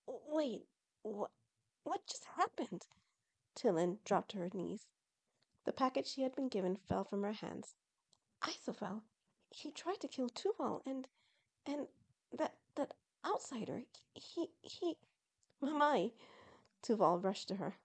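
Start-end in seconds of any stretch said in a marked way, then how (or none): 0.1-2.9 high, halting, bewildered question; stunned stammer. Acted. Bewildered character stammering after events. 8.5-15.0 soft, high, faltering voice; shocked stammering. Acted. Shocked Tillin stammers after attack. 15.6-16.1 higher call to mother; relieved cry. Acted. Son rushes to his mother.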